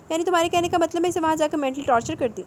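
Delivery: offended. A narrator's reading. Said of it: "سیرت نے برا مانتے ہوئے کہا".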